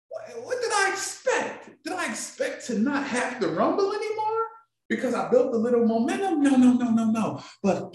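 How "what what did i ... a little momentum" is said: high, softer incredulous questions; mock self-questioning. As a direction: question yourself in a raised, light voice. Acted self-questioning about discouragement.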